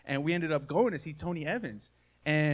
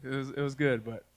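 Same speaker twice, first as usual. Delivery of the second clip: ordinary talk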